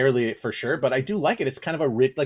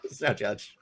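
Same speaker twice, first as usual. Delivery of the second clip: repeated through a laugh, much softer; 5 semitones lower, 8 dB quieter than usual. Real, not acted. Laughing as he repeats the joke to friends. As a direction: chuckle the words out low.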